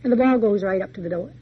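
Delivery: ordinary delivery, nothing marked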